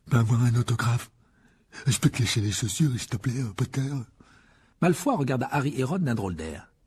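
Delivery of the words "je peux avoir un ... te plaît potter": mocking an eager young fan; imitating Colin Creevey. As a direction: ape the star-struck kid. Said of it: "en imitant Crivet".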